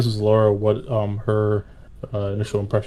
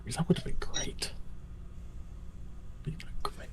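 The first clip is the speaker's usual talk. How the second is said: unvoiced whispered aside; whispering; 6 semitones higher, 16 dB quieter, faster than usual. Real, not acted. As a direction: breathe it under your breath.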